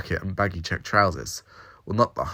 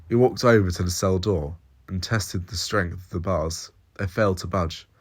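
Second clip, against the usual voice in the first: plain reading voice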